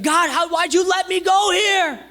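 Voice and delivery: high, loud whining at God; whiny complaint. Retold or imitated. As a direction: complain to God like a stung child. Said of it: "blame it on God".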